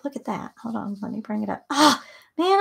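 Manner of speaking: ordinary delivery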